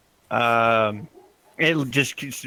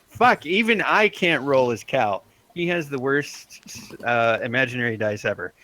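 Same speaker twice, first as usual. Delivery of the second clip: ordinary delivery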